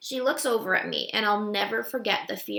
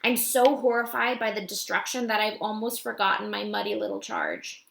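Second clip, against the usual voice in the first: ordinary delivery, nothing marked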